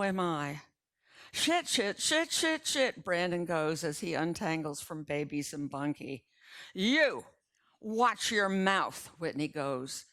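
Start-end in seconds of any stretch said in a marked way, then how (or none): 1.3-3.0 panicked cursing. A narrator's reading. Brandon cursing in the wrecked car, high panicked yelling. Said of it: "screaming their heads off". 6.7-9.1 louder, slow scolding; raised voice. A narrator's reading. Grandmother scolding Brandon for swearing, slow raised voice. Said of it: "first time I ever heard her raise her voice".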